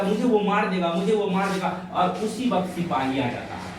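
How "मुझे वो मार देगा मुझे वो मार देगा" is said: high, loud imitation of a frightened son; alarmed retold cry. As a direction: cry out as if hunted, acted. बेटा जान के डर से दोहराता. (डर भरी ऊँची पुकार का अभिनय)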